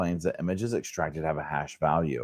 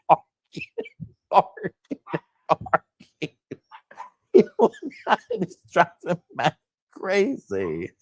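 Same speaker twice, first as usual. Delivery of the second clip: high words choked out by giggles; helpless laughter; 12 semitones higher than usual. Real, not acted. Host complaining about the barking dog, laughing helplessly. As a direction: squeak it out between gasps of laughing. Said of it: "I cannot stop".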